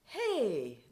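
lilting, joyful hey from across the car; joyous lilting call. Retold or imitated. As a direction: call out like you just found what you were looking for. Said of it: "It had a joyous, lilting quality".

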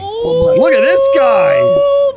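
drawn-out high shout; excited. Real, not acted. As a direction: stretch it out, hyped and loud. Hyping teammate's cooking.

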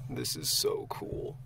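soft slow whisper in a cave. Real, not acted. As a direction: breathe the words in awe. Hushed inside the cave.

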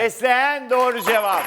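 loud, high celebratory shout; coşkulu doğru cevap anonsu. Real, not acted. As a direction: shout the winning answer to the studio. Host calls out correct answer. (coşkuyla, yüksek sesle bağırarak)

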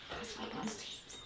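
soft fast whisper. A narrator's reading. Narrator confides to children, whispered. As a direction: whisper it like a secret. Secret told to listeners.

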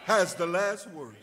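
loud, rising preacher's whoop; preaching at full force. Acted. Preacher shouting faith to the congregation, full force.

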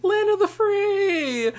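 high, loud, slow laughing cheer; shrieking mock cheer through laughter; mock patriotic cheer. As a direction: ham up a gleeful freedom shout. Mocking America, cheering.

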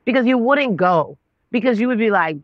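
ordinary delivery

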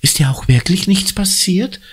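high, fretful woman's voice; distressed woman. Acted. Woman rushes to Conny, fretting. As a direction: fuss over the girl.

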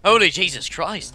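usual speaking voice

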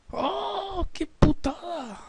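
pained high cry, slow; anguished cry. Real, not acted. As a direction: wail it out in frustration. Opponent scores, anguished cry.

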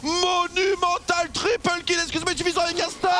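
slow drawn-out announcer shout; fighting game announcer. Real, not acted. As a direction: stretch out the name like an arena announcer. Annonce du triple et quadra kill. (annonceur de jeu de combat)

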